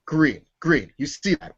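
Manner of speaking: ordinary delivery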